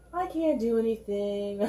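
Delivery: sarcastic whiny voice. Pretend. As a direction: whine it like a helpless victim, sarcastically. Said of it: "that's a hugely sarcastic voice".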